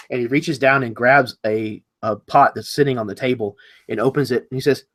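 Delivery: ordinary talk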